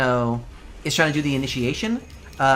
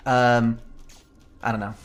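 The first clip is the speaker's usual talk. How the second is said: normal talking voice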